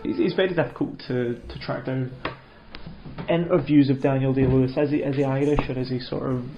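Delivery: usual speaking voice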